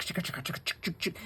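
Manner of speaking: soft voiceless clicking "chick, chick" car noise. Retold or imitated. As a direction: click out the engine sound under your breath. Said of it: "it sounds like a chick".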